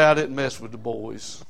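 ordinary talk

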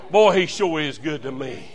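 ordinary talk, nothing marked

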